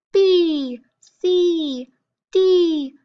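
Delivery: high, loud letter names. Shown as a demonstration. Teacher reciting consonants for pupils, high voice. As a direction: chant each consonant high, sliding down. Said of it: "in a high voice".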